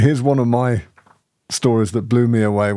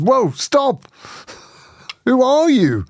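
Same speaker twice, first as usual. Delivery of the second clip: high, urgent, astonished demand; startled calling out; 13 semitones higher, slower than usual. Retold or imitated. Speaker re-enacts halting the man at the wall, startled. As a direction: stop him with a high incredulous cry. Retold halting the departing visitor.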